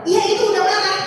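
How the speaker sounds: high, loud retort as a daughter; mock a child's insistent comeback; imitated daughter. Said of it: "katanya gitu ya". (menirukan anak membantah dengan nada tinggi)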